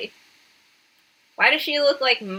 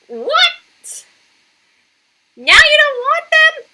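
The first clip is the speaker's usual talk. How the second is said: high, louder indignant disbelief; indignant squeal; 5 dB louder, much slower than usual. Real, not acted. Scolding the heroine for rejecting her parents, high indignant squeal.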